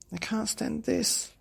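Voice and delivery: high slow despairing whine; desperate dad bear. Acted. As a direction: at the end of his rope. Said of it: "said Daddy Bear".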